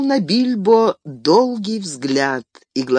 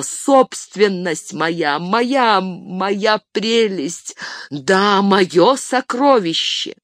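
slow, drawn-out, obsessed crooning; a hint of Gollum; 5 semitones higher, much slower than usual. A narrator's reading. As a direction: caress the words like a treasure. Bilbo turning Gollum-like over ring.

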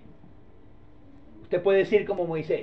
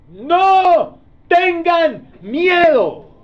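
loud, high proclamation with every word drawn out; exhortación enérgica; 9 semitones higher, 9 dB louder, much slower than usual. Acted. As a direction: proclaim it slowly and forcefully, high above your usual voice. Moisés exhorta al pueblo. (exhortación proclamada, aguda y muy alargada)

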